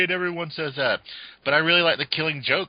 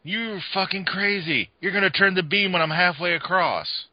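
mock lunatic; 9 semitones higher than usual. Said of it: "He's like".

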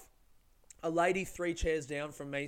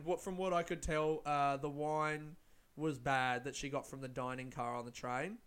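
ordinary delivery, nothing marked

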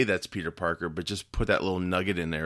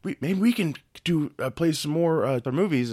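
higher, tentative put-on suggestion; raised, halting mock proposal; mock studio voice; 7 semitones higher than usual. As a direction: imitate an executive hesitantly floating an idea. Voicing studio wanting more.

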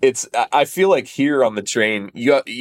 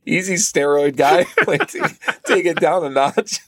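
higher-pitched, laughing mock placation; amused calming; 6 semitones higher than usual. Drummer to snappy bandmate, laughing mock placation. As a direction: talk him down through your laughter.

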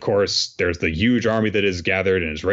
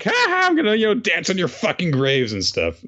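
gloating villain; 10 semitones higher than usual. Retold or imitated. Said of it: "he's just like".

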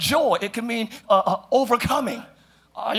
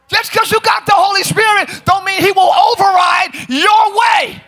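high, strongly projected sermon voice; full-force preaching; 8 semitones higher, 5 dB louder than usual. Real, not acted. Preacher urging Christians to separate light from darkness, full-force preaching. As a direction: proclaim it with mounting force.

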